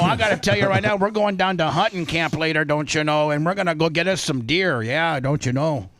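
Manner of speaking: put-on playful Minnesota accent, leaning on "don't you know". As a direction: tease the caller with a folksy Minnesota lilt. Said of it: "it's just my accent".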